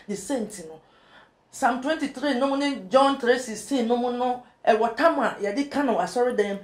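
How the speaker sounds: ordinary talk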